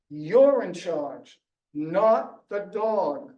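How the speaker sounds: slow, deliberate, each word weighted; firm command. Retold or imitated. Trainer instructing owner, slow firm command. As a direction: lay down the law slowly. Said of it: "said to me".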